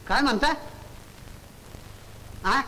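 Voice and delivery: ordinary talk, nothing marked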